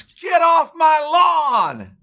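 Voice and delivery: drawn-out, high-pitched yell; re-enacted scream. Homeowner yelling at a little girl on his lawn, re-enacted scream. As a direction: bellow it slowly, as if at full lung power. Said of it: "screamed at the top of my lungs".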